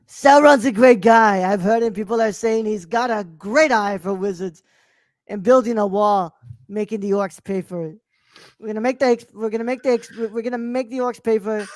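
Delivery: hammy politician's stump-speech impression; Donald Trump. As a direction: boast in short repeated phrases, like a campaign rally. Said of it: "say this in the Trump voice".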